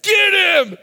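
rally the crowd, get him; rallying shout. Retold or imitated. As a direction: kids yelling to start the chase. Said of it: "they were like".

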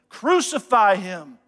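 slow, loud retold crowd cry; retold crowd demand. Preacher voices the crowd answering Pilate, loud demand. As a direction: punch it out slowly as the crowd's verdict. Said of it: "And they all said".

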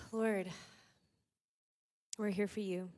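usual speaking voice

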